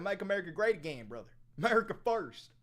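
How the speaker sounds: hammed-up Southern accent, played stupid; dumb Southern accent. Streamer doing a dumb Southern accent for slogans. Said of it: "Put it on the accent".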